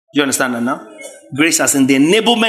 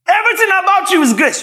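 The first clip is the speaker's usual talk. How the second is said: mocking critic; 13 semitones higher than usual. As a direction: toss it out like a jeering critic. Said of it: "mocking critics".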